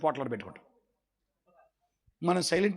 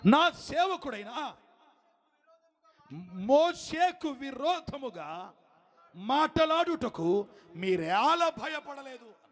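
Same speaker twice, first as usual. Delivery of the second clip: నా stressed, every word slow and higher; slow stressed scripture; 7 semitones higher, much slower than usual. Shown as a demonstration. Preacher reading God's rebuke to the congregation, slow and stressed. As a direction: read God's rebuke slowly with weight on నా. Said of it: "స్తోత్రం".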